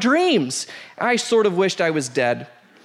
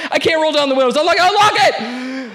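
higher and louder, frantic pleading; panicked yelling; 7 semitones higher, 5 dB louder than usual. Retold or imitated. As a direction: shout it like you're trapped. Panicked in car, fart cloud.

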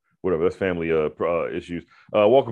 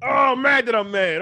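mock fury; 13 semitones higher, 4 dB louder than usual. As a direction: stretch it out in self-annoyed disbelief. Acting out his anger at himself.

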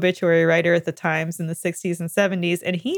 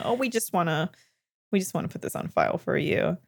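softer, smoothing it over like a polite excuse; mock-soothing, downplaying the request; euphemistic; 6 dB quieter than usual. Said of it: "almost euphemistically say".

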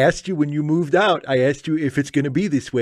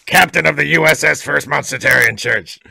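louder booming monster announcement; Fundy Monster voice; 2 semitones higher, 6 dB louder than usual. Acted. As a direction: boom it like a monster captain. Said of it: "That's my Fundy Monster impression".

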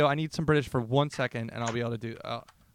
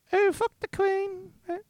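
very high, slow mock voice; put-on high voice. Joking about what is said over here, high drawn-out voice. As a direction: draw it out in falsetto. Putting on a voice for joke.

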